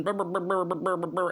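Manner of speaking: babbling brr noises, lips buzzing; family droning on, a buzzing mock babble; mock blather.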